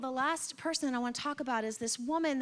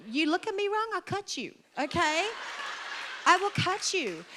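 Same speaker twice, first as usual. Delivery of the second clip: higher, sassy warning; mock threat; 6 semitones higher than usual. Woman fed up after days of her cycle, mock threatening. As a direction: threaten in joking earnest.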